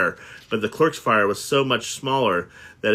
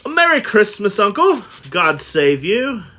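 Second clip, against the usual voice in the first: bright, much higher and louder, calling out; cheerful greeting; 11 semitones higher, 6 dB louder than usual. Acted. Nephew bursting in to greet Scrooge, cheerfully calling out. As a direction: hail the grumpy uncle with a big cheerful shout. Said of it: "cried a cheerful voice".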